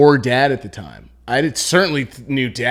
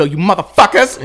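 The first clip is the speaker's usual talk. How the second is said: loud, higher mock-angry yell; mad mom; 6 semitones higher, 6 dB louder than usual. Child imitating mom getting mad, angry yell. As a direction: imitate mom cussing everyone out. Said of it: "whenever she would get mad".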